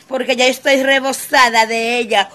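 normal talking voice

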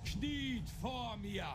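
slow, high commanding villain bark; evil emperor. Acted. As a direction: draw out the command like a tyrant. Villain demands kneeling before fight.

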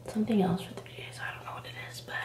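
soft secretive whisper. Real, not acted. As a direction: drop to a whisper. Whispering the forbidden thing.

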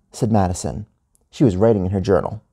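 ordinary delivery, nothing marked

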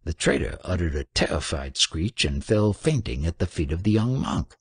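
plain reading voice

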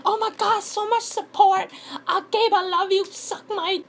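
very high-pitched mock gushing; gushing perfect YouTuber. Perfect YouTuber thanking fans for support, mock gushing. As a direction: squeal it like an over-thankful YouTuber. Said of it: "I'm not that perfect YouTuber who has, like".

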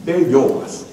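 ordinary talk

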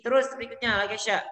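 normal talking voice

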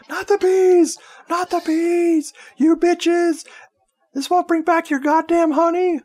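very high, hammed-up shriek; mock screaming. Imitating Sabrina as the bees are released, mock screaming. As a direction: imitate a film victim screaming in shrill panic. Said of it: "she immediately starts screaming".

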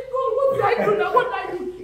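high, loud panicked squeal; mock panic. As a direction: play the startled, frightened costumer. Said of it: "I say".

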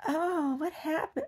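mock concern. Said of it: "And I'm just like".

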